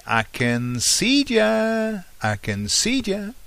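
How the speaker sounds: very high, slow singsong I can see ya; child playing hide and seek calls out; playful child. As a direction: peek out and tease like a small child at hide and seek. Child peeking over chair.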